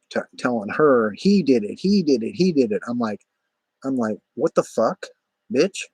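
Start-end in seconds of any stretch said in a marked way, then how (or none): 1.2-2.8 imitating an insistent accuser, higher and louder; raised, insistent mock finger-pointing; mock accusation. Neighbor pointing, accusing him to another. 4.3-5.8 higher-pitched disbelief, rising; mock outraged comeback; retold indignation. His reaction to being accused.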